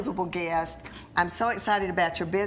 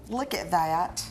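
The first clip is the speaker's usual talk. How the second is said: ordinary talk, nothing marked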